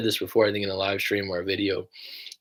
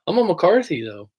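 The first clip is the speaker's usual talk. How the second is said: indignant protest; 8 semitones higher, 4 dB louder than usual. Retold or imitated. Protesting to dismissive Irish lads.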